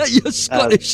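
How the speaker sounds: high, laughing through the words. Real, not acted. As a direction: lose the thread in real laughter. Cracked up by guest's accent.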